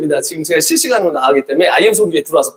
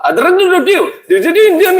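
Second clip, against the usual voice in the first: much higher, rolled drrr sounds with a laugh; mock Indian babble; 12 semitones higher than usual. Preacher opening his Indian caricature with high rolled trills. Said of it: "This is Indian people, they talk this".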